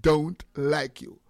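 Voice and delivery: slow, deliberate family-voice demo; half-pace, carefully measured statement. Shown as a demonstration. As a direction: space out every word evenly. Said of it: "Let me say it in a family voice".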